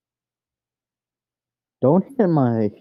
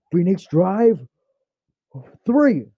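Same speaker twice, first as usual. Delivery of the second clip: rising, loud battle cry of the move name; hyped attack call; 6 semitones higher, 4 dB louder, slower than usual. Retold or imitated. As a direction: shout the move like an anime hero. Hero calling out his move.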